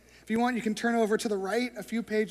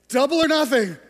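louder, higher gambler's plea; desperate gambler; 5 semitones higher, 10 dB louder than usual. Retold or imitated. Gambler pleading to the dealer, louder and higher. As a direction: throw it out like a last desperate bet. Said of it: "And he goes".

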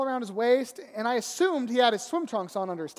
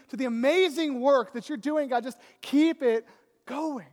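loud, higher-pitched eager pledge; impassioned, rising-energy praying; fervent prayer; 5 semitones higher, 7 dB louder than usual. Retold or imitated. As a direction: pour out an all-in plea to God. Retold prayer turns into fervent pledge.

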